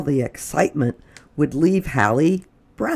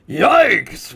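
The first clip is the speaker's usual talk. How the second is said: startled yelp; 7 semitones higher, 6 dB louder than usual. Acted. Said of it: "whispered one burglar".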